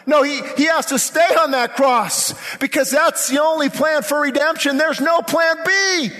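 high, insistent sermon climax; full-force preaching. Acted. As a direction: proclaim it with fierce conviction and stretched key words.

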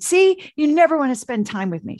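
imitating an upset wife snapping at her husband; upset accusation. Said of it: "And she got very upset".

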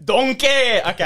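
Shrek. Acted. Friend asked for best impression, Shrek voice. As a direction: call out like a Scottish cartoon ogre. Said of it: "Just do your best Shrek".

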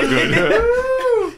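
high, hushed mock concern; mock worried parent. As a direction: act the worried family member. Role-play about a sundowning dad.

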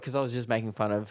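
normal talking voice

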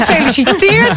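high, sing-song mock congratulation; gushing mother. The friend's mother congratulating her, high and mock-gushing.

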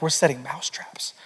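hushed, breathy secret reply; whispered answer. Retold or imitated. Dad whispering to his five-year-old about the traps. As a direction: whisper it like a conspiracy.

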